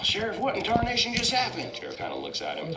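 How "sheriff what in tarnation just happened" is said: high-pitched baffled cartoon truck; Mater. Truck asks the sheriff what happened, high character voice. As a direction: pitch it way up, bewildered. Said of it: "He's all".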